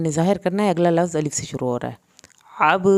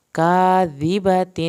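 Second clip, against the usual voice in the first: "کاذبۃ" slow and louder; slow recitation; 3 semitones higher, 6 dB louder, much slower than usual. Shown as a demonstration. Teacher to students: slow recitation. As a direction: intone "کاذبۃ" as verse. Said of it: "ظاہر ہوگا".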